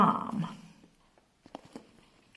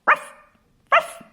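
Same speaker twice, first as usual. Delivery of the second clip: small yappy barks, louder on the second; small dog bark; 10 dB louder than usual. Pretend. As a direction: yap like a little dog. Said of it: "What sound does it make".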